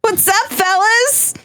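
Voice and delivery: very high, drawn-out squeaky greeting; exaggerated high-pitched hello; mock high voice. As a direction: squeak it out slowly as a joke. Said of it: "me going".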